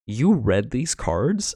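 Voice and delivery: slow, questioning mock disbelief; drawn-out incredulous question; imagined reaction. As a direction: voice the look on someone's face. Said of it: "I thought that face read".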